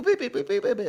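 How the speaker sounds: high, loud beeping squeaks; squeaky beeps. Retold or imitated. Streamer mimicking girlfriend's panicked noises, high beeps. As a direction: beep like a scared little alarm.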